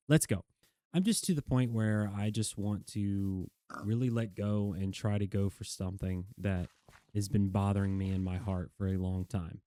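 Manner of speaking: ordinary delivery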